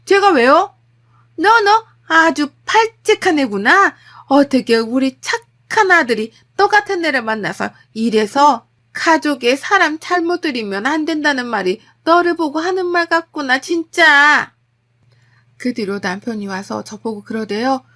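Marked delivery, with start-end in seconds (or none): 0.0-0.7 high, loud, defiant retort; shouting back. Acted. Wife who can't hold back any longer, shouting. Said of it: "저도 참지 않고 질렀습니다". 1.4-14.5 loud, high, scornful tirade; scandalized mother-in-law. Acted. Mother-in-law blaming the wife for marrying in, scandalized.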